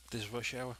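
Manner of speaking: ordinary delivery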